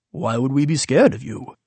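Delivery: different voice. Acted. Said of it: "Then a different voice replied".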